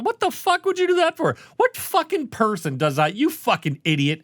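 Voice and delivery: high, mock-outraged heckling; a man heckling a horror film from his couch, mock-outraged; yelling at the screen. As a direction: heckle the movie like a fan at home. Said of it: "laughing and yelling at the screen".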